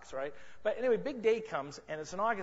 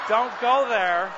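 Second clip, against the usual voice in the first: slow, much louder, drawn-out warning; mock warning; 12 dB louder, much slower than usual. Real, not acted. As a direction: stretch each word out as a loud playful warning.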